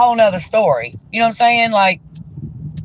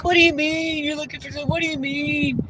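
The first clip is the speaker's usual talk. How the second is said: mocking girlfriend; 6 semitones higher, 7 dB quieter than usual. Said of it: "she's like".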